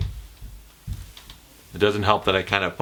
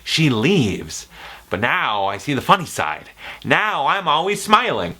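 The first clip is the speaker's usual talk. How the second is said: Joker monologue. Retold or imitated. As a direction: lean in and unsettle the listener. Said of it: "It's a monologue".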